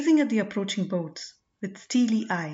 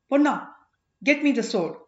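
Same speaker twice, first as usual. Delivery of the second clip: sudden loud call to his servant; a king barking an urgent order; urgent command; 5 semitones higher, 6 dB louder than usual. Acted. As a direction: snap out of it and order the sword.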